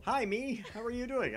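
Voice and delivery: high, loud silly self-greeting; playful high-pitched hello to himself; mock self-greeting. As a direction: greet yourself in a goofy high voice.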